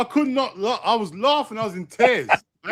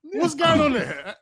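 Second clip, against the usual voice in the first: imitating his own surprise; 7 semitones higher than usual. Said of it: "I was thinking".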